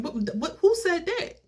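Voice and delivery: very high, sputtering question; incredulous challenge. Retold or imitated. Niece disputing the abandoned plan for grandma's house, incredulous. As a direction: sputter in disbelief, pitch shooting up.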